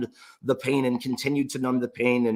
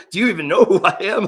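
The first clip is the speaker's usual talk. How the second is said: stunned disbelief; 8 semitones higher, 8 dB louder, faster than usual. Retold or imitated. Quoting himself, stunned.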